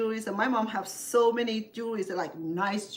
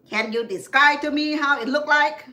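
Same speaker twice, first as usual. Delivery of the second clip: higher, louder, blunt big-brother voice; imitating older brother; 7 semitones higher, 11 dB louder than usual. Older brother calling his sister about her loss, blunt and loud. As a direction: bark it like a gruff older brother. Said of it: "that's the way he talked".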